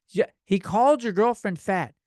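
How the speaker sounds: usual speaking voice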